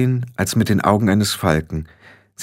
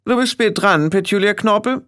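put-on high-pitched bossy young witch; imperious scolding, pitched high; snapping; 11 semitones higher than usual. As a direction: snap a rebuke at a latecomer. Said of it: "schnappte Anagramma".

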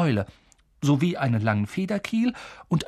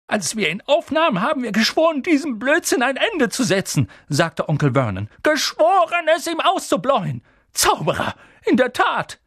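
hoch, laut und verbissen; wütend, mit Nachdruck; zorniger Onkel; 11 semitones higher, 4 dB louder than usual. A narrator's reading. (Onkel Vernon empört sich über den Zauberer)

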